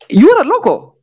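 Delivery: you ARE a local, pitched sharply up on are; emphatic stress. Real, not acted. Telling the Kenyan woman she is a local, with a high stressed are. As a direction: insist that are is the key word. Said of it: "lecturing the woman in the book".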